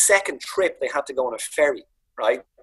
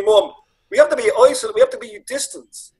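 mock dad voice; 7 semitones higher than usual. Dad voiced insisting on isolation.

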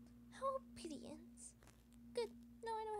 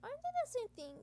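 incredulous retort; 9 semitones higher, 7 dB louder than usual. Acted. Heroine retorts to thieves.

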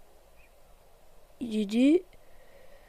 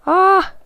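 frustrated cry; 9 semitones higher, 8 dB louder than usual. Real, not acted. Failing the obstacle.

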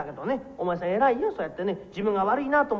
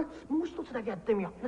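usual speaking voice

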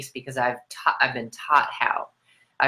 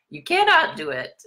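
high, pushed cartoon taunt; mock taunt. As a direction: tease like a cartoon villain. Playful taunt.

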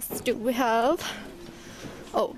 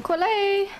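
calling out; 11 semitones higher, slower than usual. Real, not acted. Calling a pony across the dark stable. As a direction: call the pony's name up high.

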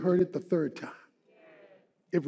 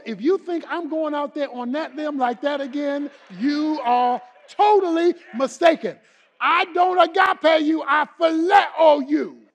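raised, loud, insistent protest; emphatic refusal; 8 semitones higher, 7 dB louder than usual. Retold or imitated. Peter refusing to go out on that limb, emphatic protest. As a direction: push back hard, voice pitched up.

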